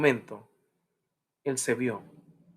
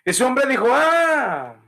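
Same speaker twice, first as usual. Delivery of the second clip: raised, loud pulpit preaching; fiery preaching; 10 semitones higher, 4 dB louder than usual. Acted. Pastor urging the congregation in a fiery sermon. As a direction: preach it out high and hard to the crowd.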